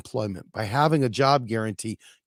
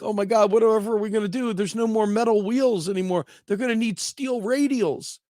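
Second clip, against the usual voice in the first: higher and fast, a hammed-up fretting craftsman; mock alarm, pitched way up; panicked wagon wheel maker; 9 semitones higher than usual. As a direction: rush it out like a frantic old wheelwright. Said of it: "He's like".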